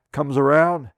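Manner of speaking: normal talking voice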